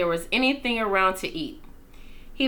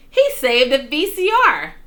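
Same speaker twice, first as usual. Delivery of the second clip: higher, louder joyful exclamation; delighted cry; 6 semitones higher, 7 dB louder than usual. A narrator's reading. Father praising the dog, crying out with joy. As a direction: shout the good news proudly. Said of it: "cried Father".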